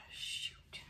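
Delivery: sigh, then a whispered shoot. Real, not acted. Artist ruins her pour, sighing whispered shoot. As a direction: let it slip out under a sigh.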